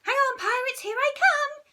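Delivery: very high, loud heroic shriek. Acted. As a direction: charge in squealing. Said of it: "shrieked Peter".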